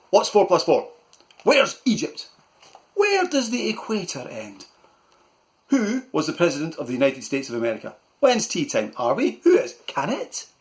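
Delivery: rapid-fire barked questions, high and loud; barking uncle. Acted. Uncle barking questions at Malcolm, high and loud. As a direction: fire questions at the boy like a drill sergeant. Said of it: "he barked questions at Malcolm".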